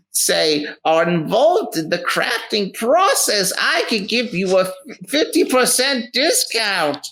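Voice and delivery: very high-pitched theatrical wizard; eccentric wizard merchant. Acted. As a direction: play a welcoming, showy wizard in a high voice. Wizard merchant makes offer.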